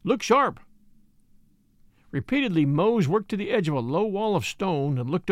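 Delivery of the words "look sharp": higher-pitched alert; mildly urgent cry; cried out. A narrator's reading. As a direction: call a brisk warning to the others. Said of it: "cried Jim".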